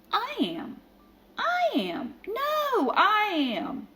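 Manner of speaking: high, squabbling cat voices; each kitten shrilly claims to be prettiest; bickering chorus of tiny cats; quarrelling cats. A narrator's reading. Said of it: "cried the hundreds and thousands".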